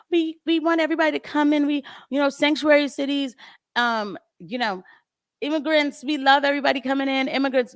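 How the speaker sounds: high, syrupy do-gooder voice; mocking Democrats. Mocking the Democrats on sanctuary cities, in a high gushing voice. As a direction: gush it like a naive politician. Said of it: "there we go again with the Democrats".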